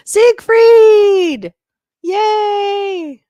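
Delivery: very high, loud yell of joy; excited shout. Real, not acted. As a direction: cheer his name across the room. Greeting arriving friend.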